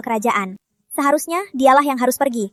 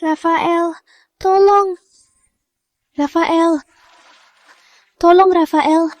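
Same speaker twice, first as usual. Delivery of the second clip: high, frantic cries to Rafael; desperate pleading; 4 semitones higher, 3 dB louder, much slower than usual. Acted. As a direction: beg for help, near tears. Begging Rafael for help.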